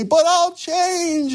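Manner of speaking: high, slow, drawn-out plea; mock tearful plea of an addict; pleading promise. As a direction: beg to be believed, stretching every word. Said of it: "they plead and they weep and they say".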